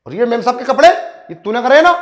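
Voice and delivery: husband accusing the servant, loud and angry; angry accusation. Acted. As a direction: confront him hard over the clothes. Husband catches servant, confronts him.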